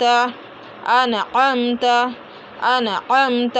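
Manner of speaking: अनअमत with light first a, then throaty squeezed "am"; slow अनअमत, the second अ from mid-throat, m held; hamza versus ain example. Shown as a demonstration. Teacher giving an example of the difference, careful recitation. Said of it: "मिसाल के तौर पर".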